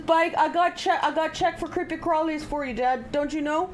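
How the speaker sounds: voicing the dog. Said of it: "Opie says".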